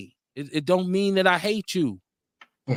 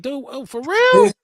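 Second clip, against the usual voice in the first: high, loud, hurt disbelief; mock-outraged friend crying out for real; betrayed disbelief; 9 semitones higher, 5 dB louder, slower than usual. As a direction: play the teammate who feels betrayed. Acting out betrayed teammate.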